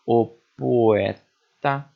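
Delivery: "O poeta" drawn out very slowly, "o" and "po" run together; slow linked syllables. Shown as a demonstration. Teacher showing students how vowels join in speech. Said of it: "a gente faz essa junção na sonoridade".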